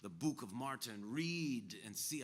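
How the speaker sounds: loud, menacing retold pronouncement; imitated aggro. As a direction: stretch and hammer the key words. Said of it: "He's being super aggro, right?".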